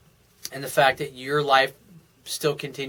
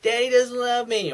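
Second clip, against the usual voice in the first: high-pitched mock whine of a little girl; crying little girl; 8 semitones higher than usual. Little girl in daddy's lap, mock crying. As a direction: imitate a tearful child complaining to daddy. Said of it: "little girl that sits in her daddy's lap crying".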